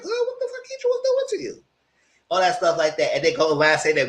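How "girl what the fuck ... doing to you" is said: very high disbelieving question; talking to a friend about her man, high and incredulous. Retold or imitated. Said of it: "I said".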